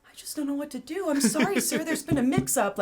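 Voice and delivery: high, meek apologetic voice; very polite. Retold or imitated. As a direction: play him as a high, timid, overly polite man. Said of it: "Like, the most polite".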